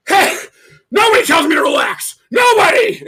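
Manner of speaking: very loud, high-pitched hollering; outraged drunk shout. Retold or imitated. Drunk buddy told to relax by the singer, outraged shout. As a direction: imitate an indignant drunk blowing up.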